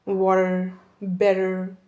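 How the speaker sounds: American English with rolling r's; American accent. Shown as a demonstration. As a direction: roll the r like an American. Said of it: "अब यही बात अगर कोई American बोलेगा".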